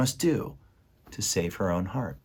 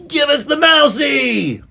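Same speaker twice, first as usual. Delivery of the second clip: very high, loud, drawn-out demand; rat shouting; 10 dB louder, slower than usual. A narrator's reading. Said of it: "shouted one of the rats".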